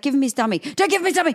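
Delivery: rising frantic retort, acted out; panicked parents. Panicked parents argue over dummy.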